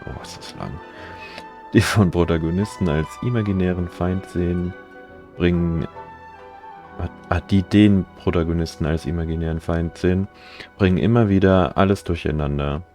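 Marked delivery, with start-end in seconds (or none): none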